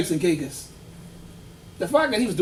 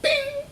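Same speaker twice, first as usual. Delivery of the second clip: high ding. Shown as a demonstration. Sound of a watchlist hit.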